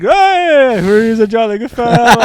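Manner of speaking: high, loud partygoer bellow; loud drunken call. Retold or imitated. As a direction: belt it like a rowdy reveller. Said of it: "we hear a big".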